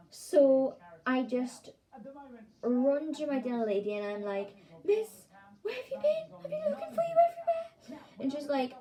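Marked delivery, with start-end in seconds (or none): 4.8-8.0 very high, squeaky imitation of a pupil; frantic child. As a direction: cry out in a put-on high little voice. Retold frantic child finds dinner lady.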